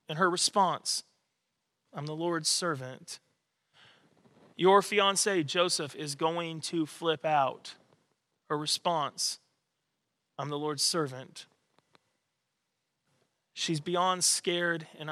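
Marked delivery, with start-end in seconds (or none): 1.9-3.2 much softer and lower, almost reverent; hushed surrender. Retold or imitated. Preacher repeating Mary's reply to the congregation, hushed and reverent. 10.4-11.4 much softer and lower, almost reverent; hushed surrender. Retold or imitated. Preacher repeating Mary's reply to the congregation, hushed and reverent.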